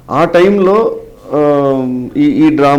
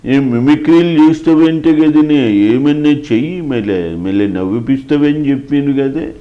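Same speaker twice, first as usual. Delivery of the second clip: put-on slow, altered voice of an elderly statesman; the prime minister, imitated, asking the mimic to entertain him, slowly; imitating the prime minister; slower than usual. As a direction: speak it slowly in the old leader's voice. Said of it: "తర్వాత ఆయన".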